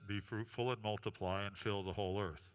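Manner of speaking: deep, grand God voice like in the movies; low booming voice of the Almighty. Acted. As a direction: speak as God commanding his people. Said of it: "God's always got that voice in all the movies".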